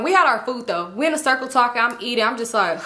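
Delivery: ordinary delivery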